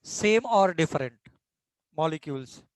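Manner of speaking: slow, even dictation pace for notes. Acted. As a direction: spell it out slowly so students can write. Teacher dictating a definition slowly. (धीमी गति से लिखवाना)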